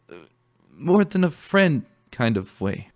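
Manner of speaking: stumbling over it with a nervous smile; nervous restating. A narrator's reading. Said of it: "he quickly restated, a nervous smile".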